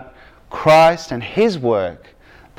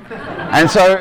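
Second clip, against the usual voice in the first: ordinary talk, nothing marked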